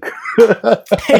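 high giggling laugh. Real, not acted. Laughs at own bit.

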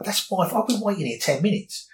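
replaying his outburst at the counter, pitch up; retold rage. Retelling his McDonald's rage to the interviewer, heated. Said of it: "going into this rage".

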